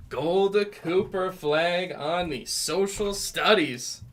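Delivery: loud, high, slow North Carolina accent calling out the cards; hammed-up North Carolina accent, loud and slow. Real, not acted. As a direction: belt the card names in a stretched-out North Carolina accent.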